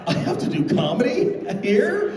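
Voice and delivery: breathy then high alarmed; gasp, then panic; hammed-up shock; mock panic. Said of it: "I'm like".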